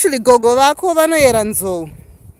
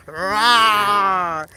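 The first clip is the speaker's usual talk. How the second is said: mild tiger roar; 6 semitones lower than usual. Shown as a demonstration. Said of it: "A tiger goes".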